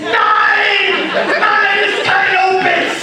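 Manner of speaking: high, full-volume comic scream; shouted retort. Acted. Answering the whispering fursuiter, a hammed-up shouted protest.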